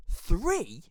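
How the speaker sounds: high, loud disbelieving "Three?"; incredulous. Retold or imitated. Boy lumped in with his bullies, high incredulous "Three?". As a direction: shoot it back in pure disbelief. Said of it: "And I went".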